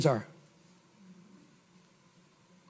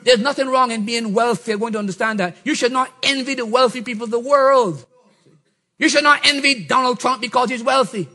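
usual speaking voice

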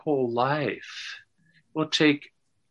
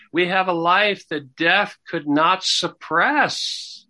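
building fervent preaching, pitched higher; 4 semitones higher than usual. Acted. Minister telling the church of resurrection life, fervent preaching. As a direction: lift the voice as the good news takes hold.

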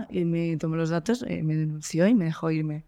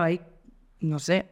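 normal talking voice

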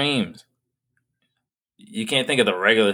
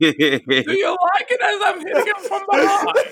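hammed-up Bane impression, laughing, slow; mock Bane voice. Joking about Bane in bed, laughing mock Bane. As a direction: play Bane in bed, cracking up.

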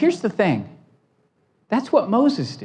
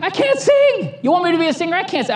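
high, whiny mock protest; panicked protest; 9 semitones higher, much faster than usual. Pastor to God, high whiny mock protest. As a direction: squeal it out like you can't believe it.

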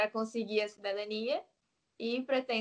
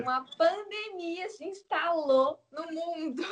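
laughing; 6 semitones higher, 4 dB louder than usual. Real, not acted. Ri ao contar a pandemia.

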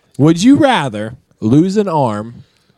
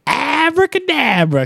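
code word call; 14 semitones higher, slower than usual. Retold or imitated. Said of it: "And then they go".

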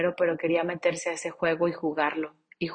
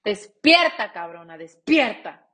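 loud cry of despierta to herself; self-rousing shout; 6 dB louder than usual. Retold or imitated. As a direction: snap yourself awake. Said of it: "Y dije".

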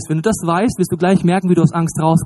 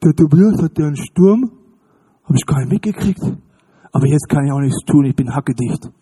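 put-on drunk God; 4 semitones lower than usual. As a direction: play God as a mildly drunk barfly who missed the storm. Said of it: "drei Komma acht Promille". (ein leicht angetrunkener Gott an der Himmelsbar)

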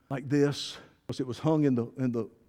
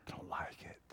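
breathy whispered complaint; hushed murmur of dislike; whispered aside; 20 dB quieter than usual. Acted. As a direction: whisper it to yourself.

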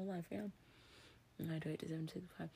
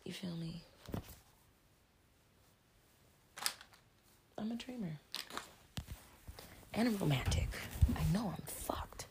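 whispering. Real, not acted. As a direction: whisper it close to the mic.

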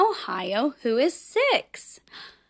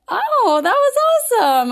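high, loud delighted praise; excited praise; 11 semitones higher, 5 dB louder than usual. Real, not acted. Teacher excitedly praising children's recitation.

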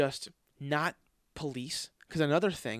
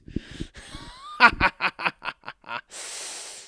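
breathy laugh; 6 semitones higher, 4 dB louder than usual. Real, not acted. Laughing after third bad shot.

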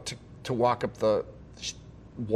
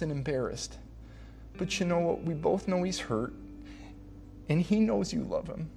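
soft, higher-pitched voice of a consoling daughter; tender reassurance; 5 semitones higher, 6 dB quieter than usual. Retold or imitated. Bride comforting her crying dad after the wedding, imitated tender reassurance. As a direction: gently soothe him, keeping it hushed.

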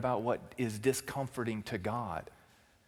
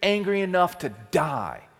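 louder, higher indignant cry; sulking prophet boiling over; mock anguished outburst; Jonah's fury; 5 semitones higher, 7 dB louder than usual. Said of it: "he says in the text".

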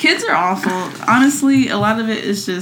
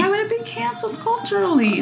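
incredulous; 12 semitones higher, 5 dB quieter than usual. Real, not acted. Incredulous question.

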